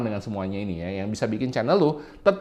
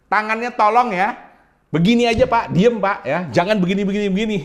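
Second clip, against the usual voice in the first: higher, louder nagging viewer; bossy complaining viewer; 8 semitones higher, 6 dB louder than usual. Retold or imitated. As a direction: scold the host about his hands. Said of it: "ada yang protes sama gua".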